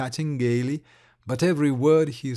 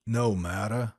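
lower, softer, shrugging it off; unconcerned; 6 semitones lower, 4 dB quieter than usual. Acted. Likharev to the worried lady, unconcerned and lower. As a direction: let it go, unbothered. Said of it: "said Likharev unconcernedly".

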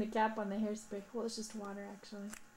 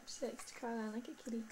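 normal talking voice

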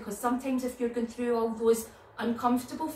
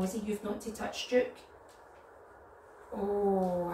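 ordinary delivery, nothing marked

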